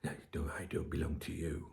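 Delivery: whispered mock reply; a mocking whispered comeback. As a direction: hiss it back as a snippy retort. Mocking women who object.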